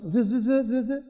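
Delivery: mock lisp, stuck on a buzzing z; a light imitation of a lisping stammer; lisping stutter. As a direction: stammer on a lisped "ze". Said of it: "je zozote".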